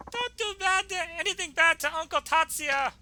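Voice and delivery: put-on very high girlish voice, urgent; high-pitched anxious protest; pleading girl. Girl defends her adoptive father.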